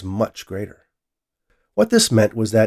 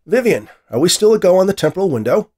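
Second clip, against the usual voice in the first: raised, louder scientist's query; calling to an assistant; 10 semitones higher, 8 dB louder than usual. A narrator's reading. Scientist asks his AI in an empty room, calling out. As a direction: call it out higher and louder.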